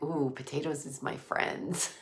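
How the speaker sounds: low, hushed cooing over food; playful mock voice. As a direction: coo it softly and low, playfully. Playfully voices love of potatoes.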